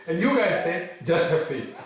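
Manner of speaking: animated imitation of sceptical listeners; challenging villagers. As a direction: push back at the preacher, pitch up. Retold villagers challenging the preacher.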